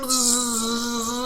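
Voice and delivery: high, long insect buzz; escaping wasp buzz. Retold or imitated. Wasp escaping a second punch, escaping wasp buzz. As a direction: buzz away frantically.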